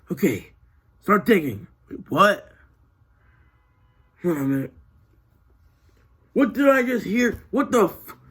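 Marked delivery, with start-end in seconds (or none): none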